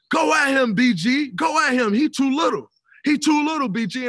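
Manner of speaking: loud trash-talk shout; Paul Pierce egging him on. Retold or imitated. Paul Pierce yelling from the bench, loud taunting shout. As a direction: bark it across the court like a heckling vet. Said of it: "he's sitting there and he's like".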